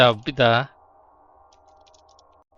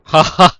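big sudden "ha ha" laugh; startled loud cackle; loud burst of laughter; 5 semitones higher, 14 dB louder than usual. Real, not acted.

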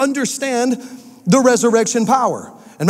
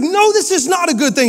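indignant king; 8 semitones higher than usual. Retold or imitated. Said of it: "He's like".

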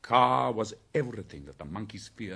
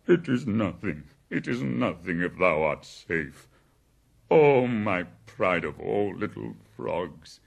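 loud, emotional whimper; whimpering bear; 10 dB louder than usual. A narrator's reading. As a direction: fuss tearfully over the rescued child, loudly. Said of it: "whimpered Baloo".

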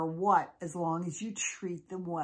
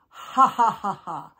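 mocking laugh; 8 dB louder than usual. Molly laughs at the witches, mocking laugh. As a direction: laugh scornfully, falling away. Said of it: "just laughed at them".

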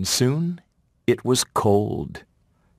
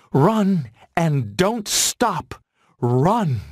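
higher, slow, spaced-out command; mother's urging voice; 8 semitones higher, slower than usual. A narrator's reading. Mother's voice in Bambi's head as he flees, slow urging. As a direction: draw out each urgent order. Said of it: "he heard his mother's voice".

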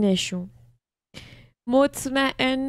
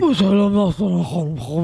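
louder, exaggerated copy of a caller; mocking imitation; 5 semitones lower, 4 dB louder, faster than usual. A viewer mimicking a man's phone greeting to Nastaran, mocking imitation. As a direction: parrot the polite greeting mockingly.

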